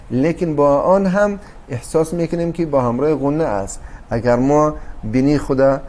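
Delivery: ordinary delivery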